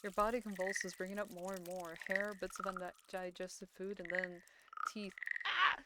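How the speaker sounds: ordinary delivery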